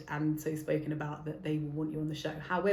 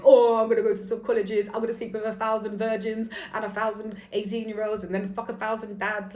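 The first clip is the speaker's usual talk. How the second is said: a hammed-up bragging lad, loud at the start; mock boast; 3 semitones higher, 7 dB louder than usual. Mocking a boastful man's plans with a sneer. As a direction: sneer it out as a cocky bloke.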